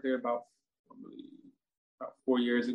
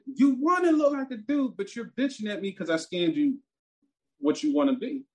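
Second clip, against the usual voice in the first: retold angry yell; 6 semitones higher than usual. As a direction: blast her with the first words. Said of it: "That's how fucking mad I was".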